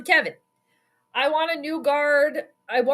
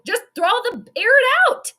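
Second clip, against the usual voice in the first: frustrated yell; 11 semitones higher, 5 dB louder than usual. Real, not acted. Fan yelling at his team's play calling, a loud frustrated shout. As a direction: voice jumps high and loud on air it out.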